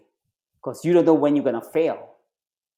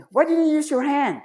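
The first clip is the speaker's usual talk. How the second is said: much higher, yelled demand; angry instructor; 12 semitones higher than usual. Retold or imitated. Instructor yelling at the recruit who nearly drowned, high angry shout. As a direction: bark the question at the recruit.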